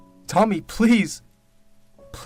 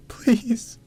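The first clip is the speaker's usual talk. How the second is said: high, loud tearful begging; sobbing high-pitched plea; wailed plea; 12 semitones higher, 5 dB louder than usual. Acted. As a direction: cry it out in despair. Weeping plea, repeated louder.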